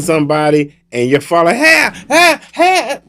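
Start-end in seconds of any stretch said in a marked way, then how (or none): 1.6-3.0 alarmed calling. Acted. Fallen victim calls out, acted.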